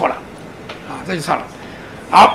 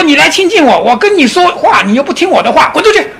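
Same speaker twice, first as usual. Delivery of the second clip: louder, higher hammed-up rebuke ending in a shout; mock scolding; 6 semitones higher, 4 dB louder than usual. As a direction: berate the monk, half laughing. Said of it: "师父又骂了".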